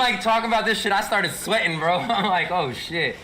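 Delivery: loud, high-pitched, laughing recount; cracking up, voice pushed way up; amused outburst. Real, not acted. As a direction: tell it loudly through real laughter. Laughing about getting sweaty mid-talk.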